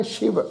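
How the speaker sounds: ਸ਼ਿਵ said "shiv" with a clear sh; sh pronunciation. Shown as a demonstration. Teacher shows the class ਸ਼ਿਵ with sh. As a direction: start ਸ਼ਿਵ with a distinct sh, not s. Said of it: "ਸੱਸੇ ਦੇ ਪੈਰ ਵਿੱਚ ਬਿੰਦੀ ਲਗਾ ਕੇ".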